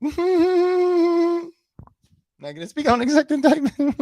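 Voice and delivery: high, quick giggly mimicry; high-pitched mock giggling; giggly mocking imitation. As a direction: mimic the interviewee through manic giggles. Mimics the interviewee between giggles.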